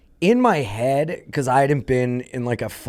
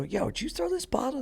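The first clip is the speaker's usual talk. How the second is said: high-pitched, held-back challenge; a comedian high and indignant at a bottle thrower; retold challenge; 12 semitones higher, 11 dB quieter than usual. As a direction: confront him, voice way up. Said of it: "I go".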